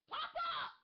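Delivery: high little squeak of a voice; tiny high call. Acted.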